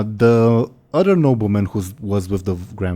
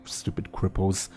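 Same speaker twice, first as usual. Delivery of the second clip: muttered under breath; 8 dB quieter than usual. Acted. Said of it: "whisper under his breath".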